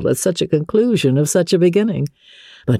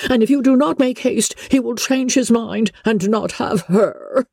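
high, flustered mother crying out for her husband; a frantic wife in an uproar, pitched high; Mrs Bennet calling out; 8 semitones higher than usual. Acted. Wife rushes into the library, high and frantic. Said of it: "called out as she entered the library".

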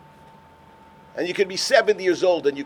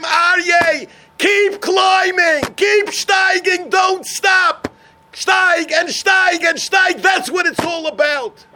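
calling out high and loud, stretching the words slowly; urgent shout; 10 semitones higher, 5 dB louder, slower than usual. Retold or imitated. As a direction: urge him onward with a big ringing cry. Chazon Ish urging him on.

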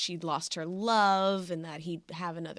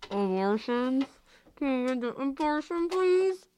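megaphone; 6 semitones higher, 3 dB louder than usual. Pretend. Speaking into megaphone.

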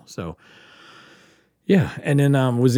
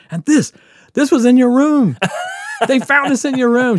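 imitating the doctor, much higher and louder, hammering it home; angry doctor ranting; 9 dB louder than usual. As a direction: scold the patient like an outraged doctor. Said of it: "And he's so angry".

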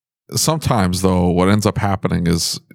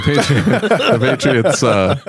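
laughing; 6 semitones higher, slower than usual. Real, not acted. Laughs at own slip "we.

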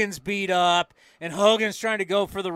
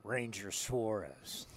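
much lower, softer, slow gunfighter; low menacing gunslinger; 9 semitones lower, 14 dB quieter, much slower than usual. Pretend. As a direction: slowly growl the name like a gunfighter. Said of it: "Just sounds like a gunfighter".

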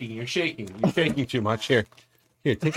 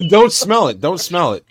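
laughing; 5 semitones higher, 8 dB louder than usual. Real, not acted. Laughing while daring friend.

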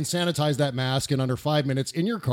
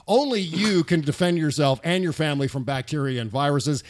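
normal talking voice

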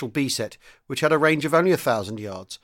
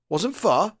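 higher-pitched, puzzled question; puzzled echo; 8 semitones higher, 3 dB louder than usual. A narrator's reading. Sergeant major echoing the young commander's answer, puzzled. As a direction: query it back, baffled. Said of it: "The sergeant major seemed puzzled".

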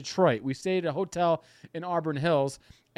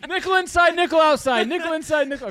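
high, loud sing-song mock of small bets; teasing a cheap gambler, high and loud; mocking bet caller; 10 semitones higher, 5 dB louder than usual. Mocking Tony's tiny nickel bets.

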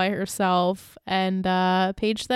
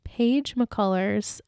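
drawn-out name; 3 dB quieter, much slower than usual. Real, not acted. Echoing slow name.

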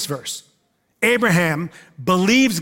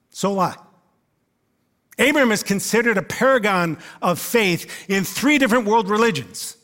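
normal talking voice

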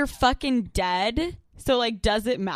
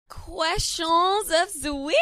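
announcer voice; 5 semitones higher, much slower than usual. Acted. Show segment intro.

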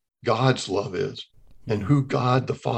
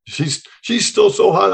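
ordinary talk, nothing marked

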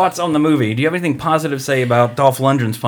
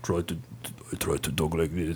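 much lower, much softer mumbled Arnold voice; Arnold impression; 11 semitones lower, 16 dB quieter than usual. Friend doing Arnold for the cohost, low and soft. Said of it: "That's exactly how he sounds".

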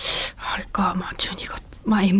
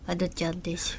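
soft, nasal, breathy Ano-chan imitation; 10 dB quieter than usual. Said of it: "ちょっともう一回やってみて".

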